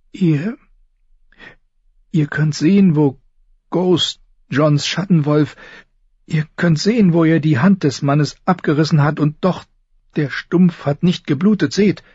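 nervous, high, halting voice; high and hesitant, feeling for each word; Samwell Tarly. Acted. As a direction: stammer timidly to a superior. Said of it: "Sams nervöse, hohe Stimme".

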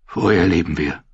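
words thick in the throat; husky voice. A narrator's reading. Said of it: "seine Stimme war belegt".

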